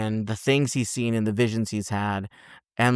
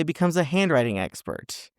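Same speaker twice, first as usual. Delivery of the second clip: usual speaking voice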